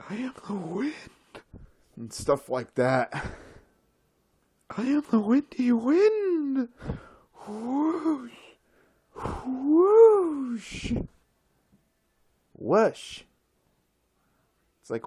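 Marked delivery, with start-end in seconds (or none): 0.1-0.9 airy whispered declaration; mock windy whisper. Playing the wind for viewers, mock whisper. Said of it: "talking like that". 4.7-13.2 high airy mock proclamation with whooshes; be the wind, hammed up high; windy wind voice. Playing the windy wind to mock airheads, high hammed-up voice. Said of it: "stop talking like that".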